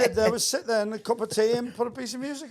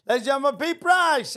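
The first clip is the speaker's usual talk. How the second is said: lightly imitated compère, pitched up; stage introduction; 6 semitones higher, 3 dB louder than usual. Compère bringing a comedian on stage, lightly mimicked announcer. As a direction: announce the act, rising louder on the name.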